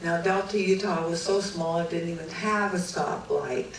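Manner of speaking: ordinary talk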